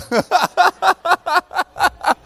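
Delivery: another high-pitched burst of laughter. Real, not acted. Camp speaker still laughing with the kids, high laugh. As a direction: let a high laugh bubble out. Laughs again after joke.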